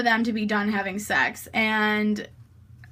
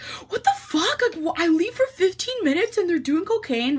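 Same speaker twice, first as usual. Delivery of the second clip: much higher, incredulous imitation; mock outrage; 7 semitones higher than usual. Nolan hears about the cocaine, mock outraged disbelief. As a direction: imitate a guy in high-pitched disbelief. Guy's outraged reaction acted out.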